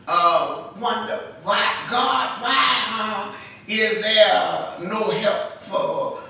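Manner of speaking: impassioned preaching. Real, not acted. Preacher voices believers asking God why, impassioned and loud. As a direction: cry out the questions with mounting force.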